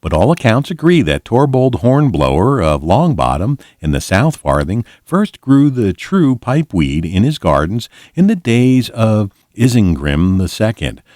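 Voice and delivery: ordinary talk, nothing marked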